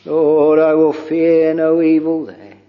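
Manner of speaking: normal talking voice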